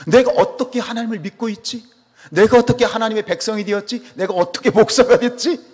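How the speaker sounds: high, loud astonished questions; incredulous, high-pitched wondering; amazed self-questioning. Acted. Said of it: "이게 놀랍다니까요".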